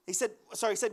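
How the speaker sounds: normal talking voice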